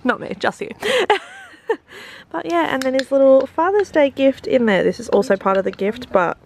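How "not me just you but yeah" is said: normal talking voice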